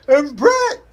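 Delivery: sudden high mock shout; Sid's yelling. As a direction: bellow the name like a wrestling promo. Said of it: "he just kept yelling".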